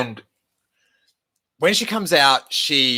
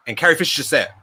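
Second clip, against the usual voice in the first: ordinary talk, nothing marked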